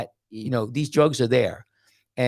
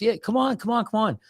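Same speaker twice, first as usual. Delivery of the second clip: high-pitched, acted urging; eager beckoning; 10 semitones higher than usual. Retold gatekeeper urges traveller into supposed heaven, eager beckoning. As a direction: wave him in, playing the keen gatekeeper.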